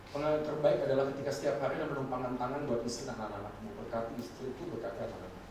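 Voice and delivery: soft, hushed blessing voice; tender. Real, not acted. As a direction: speak it gently like a prayer over family. (suara lembut penuh kasih)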